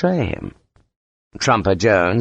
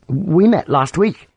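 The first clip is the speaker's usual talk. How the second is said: put-on high, drawn-out, tentative young officer; nervous young officer; 8 semitones higher, slower than usual. A narrator's reading. Young lieutenant reminding Sharp they met, nervous. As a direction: offer it slowly in a higher, unsure voice.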